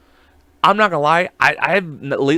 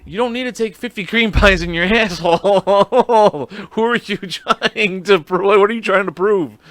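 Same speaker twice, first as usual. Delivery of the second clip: louder, higher scolding through laughter; laughing scold; 6 semitones higher, 5 dB louder than usual. Retold or imitated. Host scolding the woman in the video, laughing. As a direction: tell her off while cracking up.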